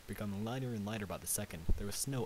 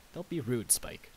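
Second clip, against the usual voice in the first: ordinary delivery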